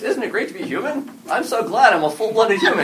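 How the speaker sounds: high, chipper best friend, laughing through it; cheerful best friend. Retold or imitated. Best friend greeting the secret alien, chirpy and laughing. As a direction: play the oblivious buddy brightly. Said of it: "dramatic irony".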